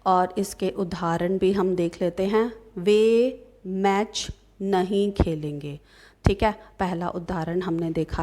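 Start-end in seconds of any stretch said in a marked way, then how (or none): 2.8-5.8 slow, spaced-out sentence for students; slow example reading. Shown as a demonstration. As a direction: read it out word by word, slowly. Teacher reads out example sentence. (धीरे-धीरे उदाहरण पढ़ते हुए)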